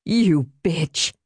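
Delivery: breath-caught, gasping insult; gasped. A narrator's reading. Marie seizing Helen's wrist, gasping. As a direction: gasp out the insult. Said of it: "she gasped".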